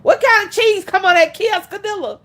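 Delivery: loud, high, confidently wrong question; said with his chest. Retold or imitated. Customer asking about the cheese, loud and sure of himself. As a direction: boldly belt the mangled "quesadilla". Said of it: "Said that shit with his chest".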